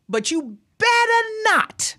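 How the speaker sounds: long, high-pitched scolding; mock warning. Host warning listeners about Mother's Day, mock scolding. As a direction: draw it out high like a mock threat.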